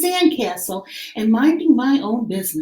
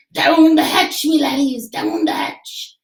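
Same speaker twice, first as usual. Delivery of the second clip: pirate yell; 4 semitones higher than usual. Acted. Braidbeard toasts his crew at dinner, hearty pirate yell. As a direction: bellow it like a rowdy captain.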